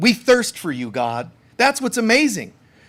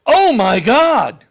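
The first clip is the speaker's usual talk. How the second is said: louder, higher, hammed-up alarm; mock panic; 5 semitones higher, 6 dB louder than usual. Jesus wrongly imagined waking up panicked, louder. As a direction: overplay a startled sleeper. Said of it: "he woke up and went".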